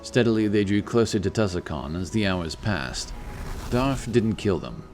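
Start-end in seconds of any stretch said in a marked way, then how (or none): none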